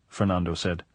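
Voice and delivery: usual speaking voice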